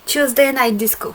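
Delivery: product name in a light English accent. Shown as a demonstration. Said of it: "avec mon accent anglais".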